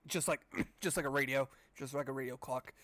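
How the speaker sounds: ordinary talk, nothing marked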